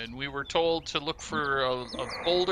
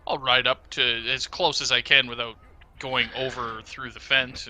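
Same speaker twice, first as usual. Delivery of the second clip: normal talking voice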